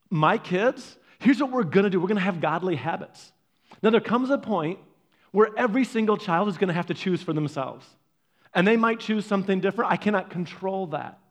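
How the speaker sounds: usual speaking voice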